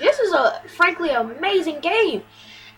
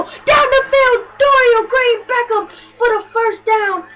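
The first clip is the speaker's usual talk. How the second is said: excited call; 6 semitones higher, 5 dB louder than usual. Real, not acted. Big run call.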